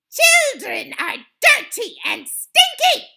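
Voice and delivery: high, very loud witch scream. A narrator's reading. Grand High Witch denouncing children, screamed. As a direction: scream the accusation at the crowd. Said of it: "screamed the Grand High Witch".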